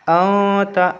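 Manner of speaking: "औ ता" with the n held long in the nose; ikhfa nasal hold. Shown as a demonstration. Teacher shows students how to read it, ikhfa nasal hold. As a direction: hum the nasal through "औ" before releasing "ता". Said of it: "आवाज़ नाक में रोकना है".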